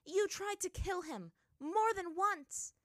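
very high, loud outraged accusation; angry accusation. A narrator's reading. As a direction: throw the charge in an enemy's face. Furious sister accuses the attacker.

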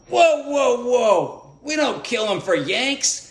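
playing the boss shouting from above; mock yell down. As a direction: call down from the chair like a gruff Aussie skipper. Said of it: "he yells down".

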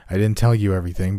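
ordinary delivery, nothing marked

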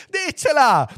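high, loud outcry; impatient demand. Acted. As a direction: cry out for the answer. Man demands the answer. (grido acuto e impaziente)

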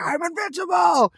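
high, strained triumphant movie-villain shout; Boris from GoldenEye. Retold or imitated. Gamer quoting a film to dad, triumphant shouting. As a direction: crow it way up high in imitation. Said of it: "thing that Boris from GoldenEye did".

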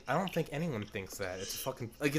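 usual speaking voice

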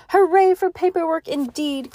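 high, emphatic cheer; excited cry. A narrator's reading. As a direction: cry it out in eager agreement. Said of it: "Klaus cried".

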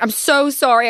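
loud, flustered apology with a falling end; frantic apology. Retold or imitated. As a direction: blurt it out in a panic.

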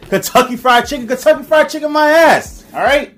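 yelling at KFC mid-rant, pitch way up. Real, not acted. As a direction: sneer the brand name to pieces. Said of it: "you don't have to yell at everybody".

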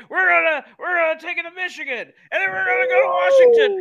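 high, loud campaign rally shout; heroic rallying shout. Retold or imitated. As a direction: rouse the crowd at full volume. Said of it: "he was like".

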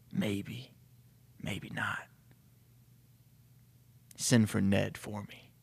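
soft, slow, breath-heavy cop; hushed grizzled chief; grizzled police chief. Acted. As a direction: answer gravely, nearly whispering. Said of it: "he's like, the deep, grizzled police chief".

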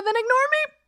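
very high, loud mock outburst; hammed-up squeal. Host reading Bob's tweet, exaggerated high loud squeal. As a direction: shoot the voice way up, loud and silly.

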